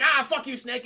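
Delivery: hammed-up taunt at the snake; mock defiance. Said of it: "Jon Voight's like".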